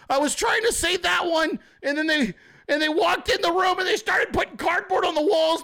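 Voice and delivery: high frantic yelling excuse. Retold or imitated. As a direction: yell your defence in a rushed panic, half laughing. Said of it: "And you're like".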